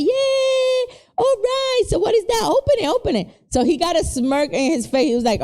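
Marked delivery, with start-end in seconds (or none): 0.0-3.3 high, bright-eyed cheer, much higher; mock-excited mom egging her son on to unwrap. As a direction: hype him up to open the gift. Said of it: "and I was like".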